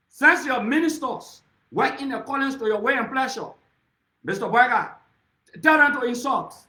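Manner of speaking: rally speaker throwing down a loud challenge; defiant shouting. Real, not acted. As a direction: challenge him at full voice. Daring the president at a rally.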